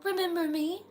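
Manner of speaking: put-on high, sweet but wrong girl's voice; the witch faking the diner girl; wrong teenage voice. A narrator's reading. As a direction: play a teen girl with something off. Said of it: "Kat's voice said, but wrong".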